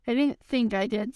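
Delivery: usual speaking voice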